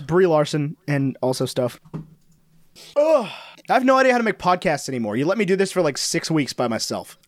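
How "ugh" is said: high groan of "ugh"; exasperated groan. Real, not acted. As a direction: let out a high, fed-up ugh. Sighing about returning to podcasting.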